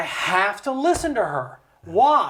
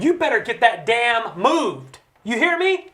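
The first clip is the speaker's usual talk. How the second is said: higher, insistent mock demand at the end; mock scolding; 4 semitones higher, 3 dB louder than usual. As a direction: lean on the congressman like an angry voter. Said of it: "he yells and he screams for us".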